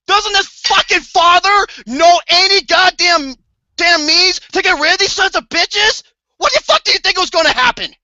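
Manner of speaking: high, loud exasperated rant; furious rant. Retold or imitated. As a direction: yell it at the movie's father. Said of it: "I'm like".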